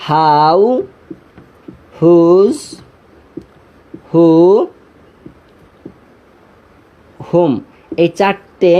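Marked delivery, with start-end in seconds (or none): 0.1-7.5 HOW, WHOSE, WHO, WHOM begun on a clear breathed h, one by one; slow, separate "how", "whose", "who", "whom", each with an audible h; pronounced with H. Shown as a demonstration. Teacher models the four question words for students, pronounced with h. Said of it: "হয়ের মতো উচ্চারিত হবে".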